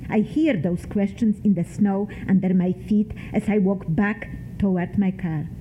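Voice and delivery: ordinary delivery, nothing marked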